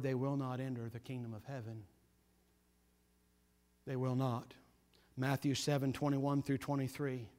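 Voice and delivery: hushed grave sermon. Acted. Preacher warning the congregation of judgment, hushed and solemn. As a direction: drop the voice to warn the flock gravely.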